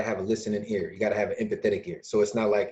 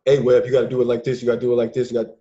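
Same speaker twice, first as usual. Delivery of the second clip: pushy lecturing; 7 dB louder than usual. Retold or imitated. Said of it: "I can't come to you like".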